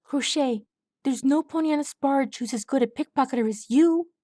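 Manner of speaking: a touch of eager exclaiming; exclaimed. A narrator's reading. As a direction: hype her up. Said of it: "Swab exclaimed".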